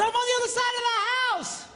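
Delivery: high, fast mock shout; calling across the house. Husband shouting to his wife across the house, high mock yell. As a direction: yell it to the far room.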